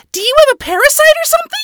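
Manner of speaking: very high, loud, rapid squeak; manic little character. A narrator's reading. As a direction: fire it off fast in a tiny squeaky voice. Nifty's line in a character voice.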